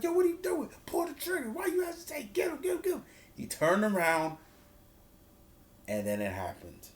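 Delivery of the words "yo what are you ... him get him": high, frantic urging of a fan; egging him on at full pitch; urging, hyped. Retold or imitated. Fan yelling at the TV for him to swing the chair.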